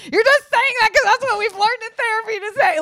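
high, loud sneering mock voice; mock his therapy lines in a squeaky sneer; mocking retort. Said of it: "And I'm like".

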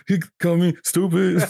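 high whiny fake sob; mock whiny crying. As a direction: whine it like a sulking kid. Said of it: "angry cry, ugly crying".

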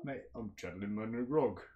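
low Scottish character voice; Grog, Scottish-tinged. Acted. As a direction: drop low into a rough-and-ready Scottish accent. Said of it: "You know what I'm channeling".

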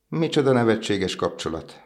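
mock them like gossiping birds; twittering swallows. A narrator's reading. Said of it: "csivitelték a többi fecskék".

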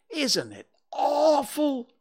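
high, drawn-out horrified little voice; Piglet. A narrator's reading. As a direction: stretch the horror out, high and loud. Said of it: "said Piglet".

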